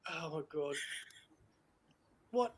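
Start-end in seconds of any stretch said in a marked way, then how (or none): 0.1-0.7 exasperated aside. Real, not acted. Host suffering another ham pun, exasperated and hushed. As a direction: say it under your breath. Despairs at co-host's second pun.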